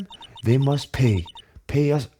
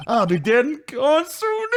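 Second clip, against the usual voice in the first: higher, slow mock despair; hammed-up self-pity; mopey [name]; 11 semitones higher, slower than usual. [name] telling Nick he'll be gone, mock despair.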